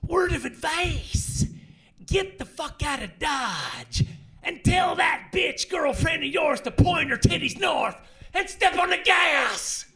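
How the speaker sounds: high, loud, slow shouted tough-guy line; movie henchman. Retold or imitated. Henchman yelling at Van Damme, loud slow tough-guy shout. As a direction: bellow it like an action movie henchman. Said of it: "and he goes".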